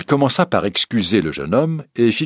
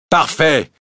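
higher, louder irritated judge; 7 semitones higher, 4 dB louder than usual. Acted. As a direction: clip it with annoyance. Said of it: "fit le président irrité".

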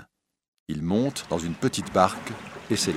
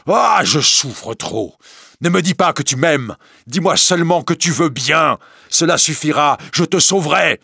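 loud, high, desperate begging; anguished pleading; 8 semitones higher, 7 dB louder than usual. Acted. Priest on his knees begging her, anguished. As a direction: beg in torment, voice raised.

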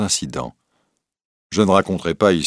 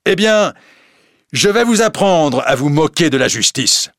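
higher, louder angry judge; furious threat; 8 semitones higher, 5 dB louder than usual. Acted. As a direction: threaten the mocking young man. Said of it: "le président était furieux".

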